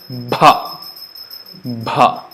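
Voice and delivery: "Bha" voiced with a breathy release; teacher models breathy "Bha"; aspirated bha. Shown as a demonstration. Teacher demonstrating voiced aspirate bha, breathy. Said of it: "with force of breath".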